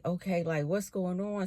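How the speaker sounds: soft, unsure murmur; quietly asking what's happening; hushed confusion. Retold or imitated.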